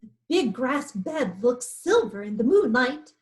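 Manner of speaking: plain reading voice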